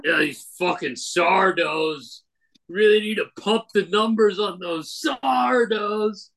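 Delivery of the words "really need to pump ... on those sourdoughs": mock executive. Mocking the bread company.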